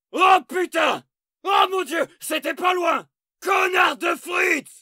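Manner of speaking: yelling warnings as rockets land; panicked shouting. Acted. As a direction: scream run, take cover. Alerte explosifs et roquettes. (cris de panique)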